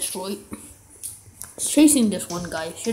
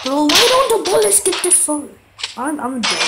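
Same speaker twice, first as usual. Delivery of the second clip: frustrated shout; 6 semitones higher, 5 dB louder than usual. Real, not acted. Losing gunfight, yelling.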